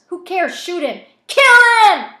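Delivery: high, loud impatient shouts; screamed goading. A narrator's reading. Voices goading gunmen to shoot Zach, screaming. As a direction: shout it urgently, building to a scream.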